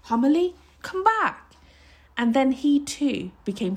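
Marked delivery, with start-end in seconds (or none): 0.0-1.5 high, urgent plea; pleading high-pitched call; frantic calling. A narrator's reading. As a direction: call them back anxiously, pitch climbing. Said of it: "called in a frantic whisper".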